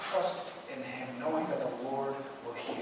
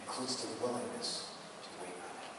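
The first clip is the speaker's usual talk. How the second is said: faster repeat, still soft; a quick echo of the line; brisk restatement; 6 semitones lower, 11 dB quieter, faster than usual. Shown as a demonstration. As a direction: run it through quickly once more. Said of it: "repeated faster".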